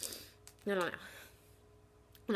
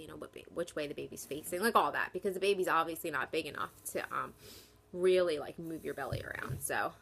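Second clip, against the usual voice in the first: ordinary delivery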